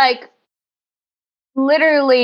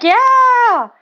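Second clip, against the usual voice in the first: mock drunk party cheer; 9 semitones higher, much slower than usual. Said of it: "and they're like".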